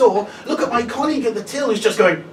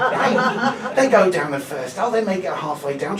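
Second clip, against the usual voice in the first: usual speaking voice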